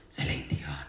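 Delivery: breathy hushed whisper of the name. Acted. Singer whispering a diva's name to viewers, hushed.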